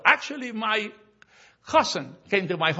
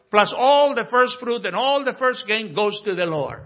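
higher, louder pulpit declamation; emphatic preaching; 6 semitones higher, 5 dB louder than usual. Real, not acted. Preacher telling the congregation why Israel was powerful, emphatic preaching. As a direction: drive it home to the congregation, high and loud.

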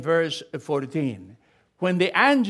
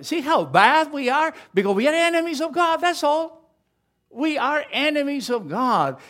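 higher and faster, pressing the point; fervent exhortation; 8 semitones higher, 4 dB louder than usual. Real, not acted. Preacher rebuking the congregation, high, quick and fervent.

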